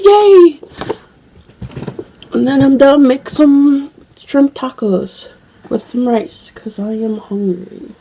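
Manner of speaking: ordinary talk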